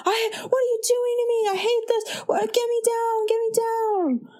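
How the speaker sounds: high-pitched whiny panic, hammed up; mock freaking out. Imitating a man stuck on the ceiling, freaking out. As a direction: wail it in a rush like a panicking man. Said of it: "he's freaking out".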